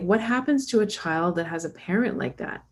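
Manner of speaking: ordinary talk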